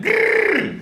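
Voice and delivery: high snarling growl. Retold or imitated. Stranger on the subway behind him, high snarling growl. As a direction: growl it like a wild animal. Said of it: "Then I heard it again".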